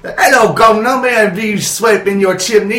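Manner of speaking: put-on cheesy Mary Poppins Cockney accent; mock Cockney accent. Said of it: "that stupid British accent".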